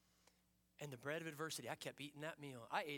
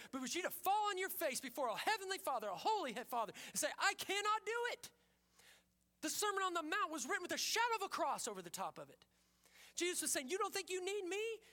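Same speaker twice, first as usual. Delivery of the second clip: raised, driving pulpit voice; preaching at full force; 11 semitones higher, 8 dB louder than usual. Acted. Pastor challenging the congregation, in a loud preaching voice.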